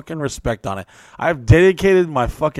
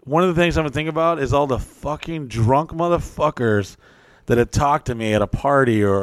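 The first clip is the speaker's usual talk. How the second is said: ordinary delivery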